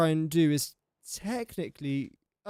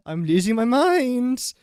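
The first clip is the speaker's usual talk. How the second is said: exasperated groan; 6 semitones higher, 6 dB louder, slower than usual. Real, not acted. Frustrated with game menus.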